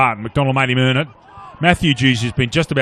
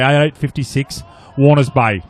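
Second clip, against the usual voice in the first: ordinary delivery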